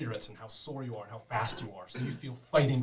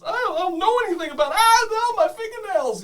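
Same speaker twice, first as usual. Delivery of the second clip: high, loud, fast terrified protest; tortured prisoner denying everything to her captor; pained pleading; 11 dB louder than usual. Acted. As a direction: cry out in pain and denial.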